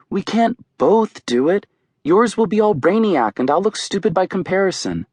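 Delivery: high, fretful sisterly appeal; anxious pleading. A narrator's reading. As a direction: plead from up high, close to whining. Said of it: "She's not whining".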